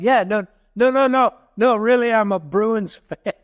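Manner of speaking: mock a fickle fan swearing loyalty, laughing at the end; mock insistent fan. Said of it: "You're like".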